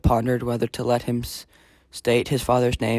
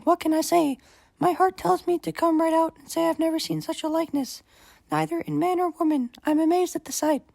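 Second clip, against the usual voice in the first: Helen's voice. A narrator's reading. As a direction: lift into a high, amazed queen's voice. Helen speaks to Menelaus.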